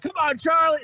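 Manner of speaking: high, loud film-hero call; rally your partner at full voice; urging shout. Retold or imitated. Retelling the film's Billy urging Charlie, urging shout.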